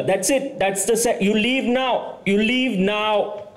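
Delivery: high pitched, slowing to a drawn-out order; mock angry heckler. Drunk woman ordering the comic off stage, high and slow. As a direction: throw him off the stage.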